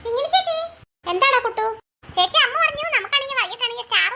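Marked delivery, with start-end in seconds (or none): 0.0-0.6 calling out. Acted. Child calls older sister over. (ഉയർന്ന സ്വരത്തിൽ നീട്ടി വിളിക്കുന്നു)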